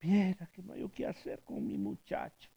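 soft, lightly voiced weeping grandmother; a touch of a distressed elderly lady; tearful old woman. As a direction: plead quietly, close to tears. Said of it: "Recibí una señora ya mayor y llorando".

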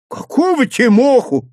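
сердитый вопрос, выше обычного; спросить раздражённо; с сердцем. A narrator's reading. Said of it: "с сердцем спросил юнец". (юнец сердито переспрашивает)

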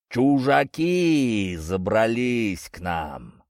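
slow, drawn-out booming giant; thunderous voice. Acted. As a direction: stretch each word like a giant. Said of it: "прозвучал громоподобный голос". (громоподобный голос великана)

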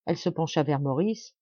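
ordinary delivery, nothing marked

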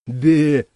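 high, loud mocking bleat. Narrator mocking the squatter's mooing, a loud teasing bleat. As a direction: bleat back at him like a sheep. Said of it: "передразнил я".

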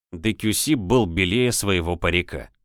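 ordinary delivery

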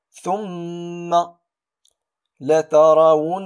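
ثم لترونها chanted with long nasal mm and nn; slow chanted ثم لترونها, humming the doubled letters; ghunna shown in recitation. Shown as a demonstration. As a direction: hold the م of ثم and the ن of لترونها. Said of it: "هل لاحظتم؟".

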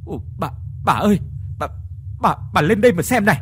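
fast, stammering, rising to a loud high call; husband stammering for his wife, startled by the mirror. Acted. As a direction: stutter out her name in fright, then shout for her. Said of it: "miệng thì lắp bắp gọi".

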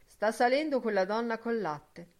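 ordinary delivery, nothing marked

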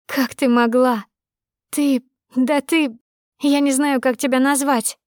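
high, slowing, choked with fury; furious reproach. Acted. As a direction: spit out the accusation at your friend. Friend confronts betrayal.

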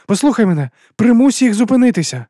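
high, loud, insistent command; urgent plea. Acted. Juliette pressing Sherly to make them stop, urgent. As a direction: beg loudly to be listened to.

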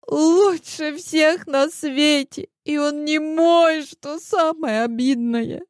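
high, slow, tearful wail; bitter crying. A narrator's reading. Heroine lamenting to friends that he left with Marta, tearful high wail. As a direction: wail it through tears, heartbroken. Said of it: "Заплакала я горько".

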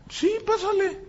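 high, soft voice of a woman; neighbor's wife. Retold or imitated. Neighbor's wife lets the young man in to pray, high soft welcome. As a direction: lift the voice high and keep it light. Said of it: "Salió su esposa".

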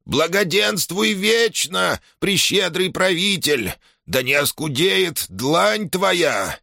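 very high, slow grovelling whine; fawning old shaman. A narrator's reading. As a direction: grovel at the prince's feet.

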